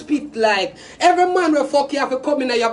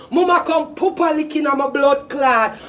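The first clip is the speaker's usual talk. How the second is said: ordinary talk, nothing marked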